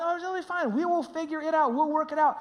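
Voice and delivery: high, breezy imitation of wife; unworried wife. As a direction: wave the worry away airily. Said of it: "She's not worried about it".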